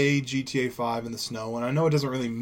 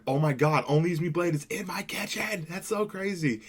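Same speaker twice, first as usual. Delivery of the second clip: amazed fan; 5 semitones higher than usual. Retold or imitated. Fan amazed YouTuber in kitchen.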